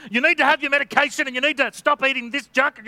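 doctor scolding a patient, harsh. Retold or imitated. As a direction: bark the orders at the patient. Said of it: "speak harshly to people".

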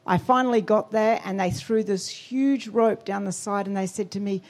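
ordinary talk, nothing marked

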